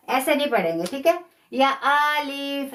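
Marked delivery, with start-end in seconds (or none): 1.9-2.7 अलिफ read a bit slow and split as या अलिफ, the wrong way; wrong alif. Shown as a demonstration. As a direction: say अलिफ slowly with a break, as a mistake. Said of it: "ऐसे भी नहीं पढ़ेंगे".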